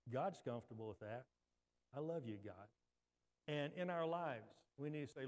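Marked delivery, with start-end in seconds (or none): 1.9-2.7 soft, low prayer; very quiet, lowered voice; quietly. Shown as a demonstration. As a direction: say it hushed and low, to God. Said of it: "just say that quietly".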